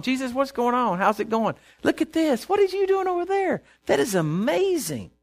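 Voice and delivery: acted-out delighted exclaiming; playful amazed chat. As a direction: chat to Jesus, climbing into high amazement. Acts out chatting with Jesus.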